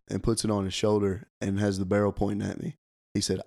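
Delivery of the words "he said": ordinary talk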